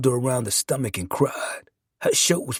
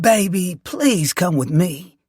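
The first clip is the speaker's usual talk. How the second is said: slow, slightly raised Southern nurse voice; lightly voiced Southern nurse; hint of Southern accent; 5 semitones higher, 4 dB louder, slower than usual. A narrator's reading. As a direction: coax him slowly in a light Southern accent. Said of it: "southern accent in her voice".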